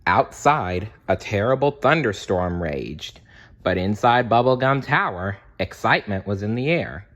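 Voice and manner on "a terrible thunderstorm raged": ordinary delivery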